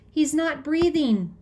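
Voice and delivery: high, slow panicked report; desperate, out-of-breath alarm; gasped out. A narrator's reading. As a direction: gasp the emergency out after running. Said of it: "I gasp".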